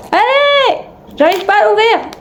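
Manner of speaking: straining high, pushing hard; effortful grunt while opening; opening a stuck jar. Real, not acted. Struggling to open a package.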